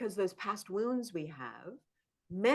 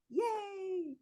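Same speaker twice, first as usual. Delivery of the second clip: mock cheer; 13 semitones higher than usual. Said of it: "cheering repair again".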